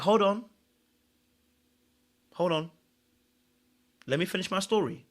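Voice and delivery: softly acted, composed interruption; cool, unhurried. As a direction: quietly hold the floor, with long pauses. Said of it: "she was so cool".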